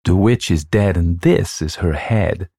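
plain reading voice

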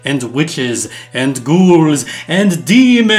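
building spooky villain. Acted. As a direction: build from menace to a shout. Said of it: "Okay, Christmas, not Halloween".

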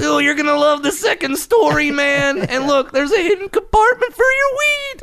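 very high cartoon stoner as real estate agent; Shaggy. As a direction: pitch way up, selling the house in a cartoon voice. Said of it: "if Shaggy was my real estate agent".

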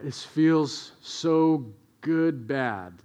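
slow, drawn-out guilty delight; savoring guilty thrill. Retold or imitated. Five-year-old savoring stolen Skittles, slow guilty delight. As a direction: stretch each word, relishing it. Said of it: "just like".

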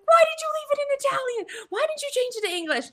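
very high, squealed mock scolding; mock yelling. Blaming a friend for the Italian menu, high mock yelling. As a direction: imitate an exasperated yell at a friend. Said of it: "Stop yelling at me".

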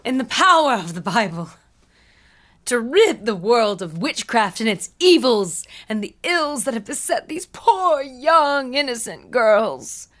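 hammed-up preacher, loud swells on lamented words; fire-and-brimstone reverend. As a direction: preach theatrically against evil, stretching the big words. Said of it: "do you have a voice and everything".

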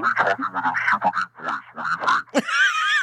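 deep voice changer evil laugh; villain laugh. Shown as a demonstration. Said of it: "That's villain".

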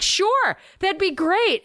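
high mock-eager sarcasm; sarcastic. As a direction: sneer a fake eager yes. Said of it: "Everyone's like".

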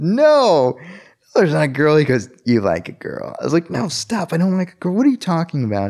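high, flustered protest; flustered denial. Retold or imitated. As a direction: deny it fast and high, embarrassed. Friend teases him about a girl.

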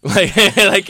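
laughing through the words. Real, not acted. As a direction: laugh it out, a little teary. Laughing before crying admission.

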